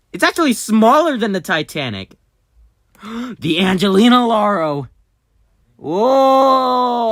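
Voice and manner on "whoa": high, loud cry of wonder; amazed whoa. Real, not acted. As the ship appears, awed cry. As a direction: let out a loud whoa of awe.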